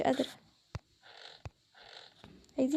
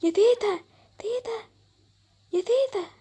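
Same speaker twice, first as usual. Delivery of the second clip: high frightened calls; calling out; 5 semitones higher, 3 dB louder than usual. Acted. Scared girls calling grandma for help, loud. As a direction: call for grandma across the house.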